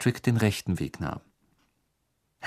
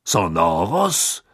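murmured spell; 3 semitones lower, much slower than usual. A narrator's reading.

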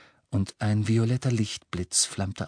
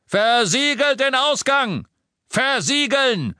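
shouted order; 7 dB louder, slower than usual. Acted. Yorksley orders sealing the exits.

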